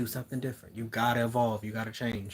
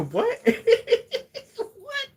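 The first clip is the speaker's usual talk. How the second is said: bursts into a high laugh, then a squeaky what; surprised laugh; 9 dB louder than usual. Real, not acted. Reader startled by the doll's message, laughing. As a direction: caught off guard, laughing in disbelief.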